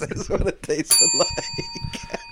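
high, wheezing laugh through the words; helpless laughter. Real, not acted. Co-host cracking up at own joke, slow wheezing squeal. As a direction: barely get it out for laughing.

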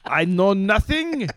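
high, loud German officer voice; Colonel Klink. Retold or imitated. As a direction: bark it like a comic German colonel. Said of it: "You do a Colonel Klink".

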